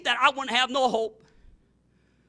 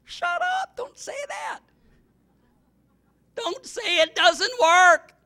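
high, loud rebuke, slow at the end; rebuking shout; 12 semitones higher, 6 dB louder, slower than usual. Acted. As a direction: shout down the doubt. Rebuking the doubter.

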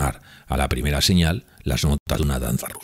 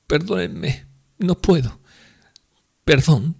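put-on weary drunk woman apologizing; 13 semitones higher, 3 dB louder, slower than usual. Grushenka apologizing high.

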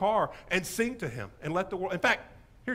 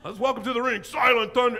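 loud, raised ring introduction; wrestling ring announcer; 5 semitones higher, 7 dB louder than usual. Acted. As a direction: hype up a wrestler entering the ring. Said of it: "sounds like a bad pro wrestler name".

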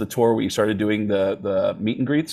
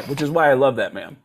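usual speaking voice

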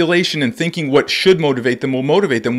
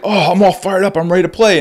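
higher and louder, imitating an amped-up kid; mock fired-up player; 7 semitones higher, 4 dB louder than usual. Players mocked as pumped up before the game, imitating excitement. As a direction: play the pumped teenager, half-mocking. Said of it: "and then they're like".

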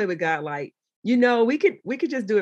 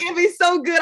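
usual speaking voice

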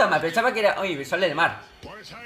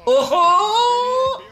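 sudden high shout; excited shout; 12 semitones higher, 4 dB louder than usual. Real, not acted. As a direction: cry out at the chance. Another near-miss on goal.

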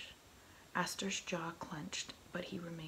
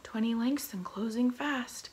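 high, loud lookout call; shout from the crow's nest; 5 semitones higher, 9 dB louder than usual. A narrator's reading. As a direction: call it down from the mast. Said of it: "came the shout from the crow's nest".

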